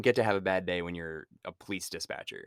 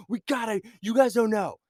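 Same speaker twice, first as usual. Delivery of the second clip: high, loud mock movie-hero urgency; climactic overacting; 12 semitones higher, 6 dB louder than usual. Mocking the officer's overreaction, hammed-up frantic voice. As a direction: play it like the film's big climax. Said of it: "acting like this is the climax of the movie".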